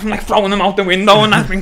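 usual speaking voice

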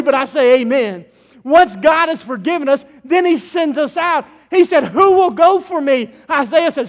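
loud, rising sermon cadence; full-force preaching. Acted. Pastor thundering Isaiah's vision to the congregation, full preaching. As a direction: preach it out to the whole sanctuary.

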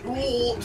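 Donkey Kong ooh. Retold or imitated. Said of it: "And Donkey Kong said".

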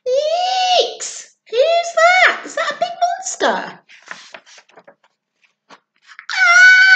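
high squealing mock fright. Reading to a child, hammed-up scared squeal. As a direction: squeal like a scared little monster.

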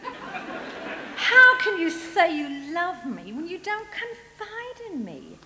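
high, soft, sulky plea; pouting. Acted. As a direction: pout and guilt-trip him in a small high voice. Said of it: "Then Delilah pouted".

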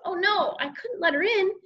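high-pitched worried storytelling; mild panic. A narrator's reading. Boy keeping his sister out, panicky. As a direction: raise the pitch in mock alarm. Boy fears being caught.

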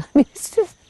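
ordinary talk, nothing marked

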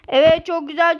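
ordinary delivery

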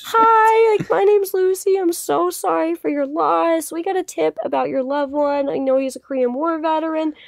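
high, polite reporter's approach; retold condolence greeting. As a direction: re-enact a sweet, careful introduction to mourners. Retold greeting of mourners at funeral.

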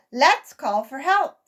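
urgent suggestion. A narrator's reading. Sammy urging the group to call for help, urgent. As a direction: urge everyone to shout. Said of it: "suggested Sammy".

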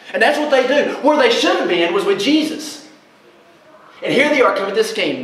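fervent preacher; preaching at full force. Real, not acted. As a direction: preach the story with rising fervour. Preaching the adultery story.

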